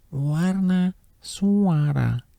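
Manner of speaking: warna sung out high; high demo of colour. Shown as a demonstration. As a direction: lift warna high, fall on suara. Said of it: "saya contohin ini".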